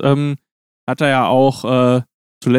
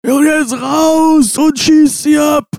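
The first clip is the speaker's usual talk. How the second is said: high, slow, shouting coach tirade; furious halftime rant; 11 semitones higher, 4 dB louder, much slower than usual. Retold or imitated. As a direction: roar them out of the dressing room. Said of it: "die Halbzeitansprache für Hoffenheim hätte auch ich halten können".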